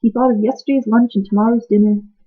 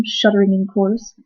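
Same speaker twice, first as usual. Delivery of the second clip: plain reading voice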